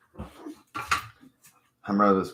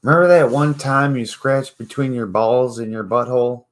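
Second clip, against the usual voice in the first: lightly louder, higher pretend pep talk; mock coaching voice; 5 semitones higher, 5 dB louder than usual. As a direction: talk him through it like a buddy. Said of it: "You have to be like".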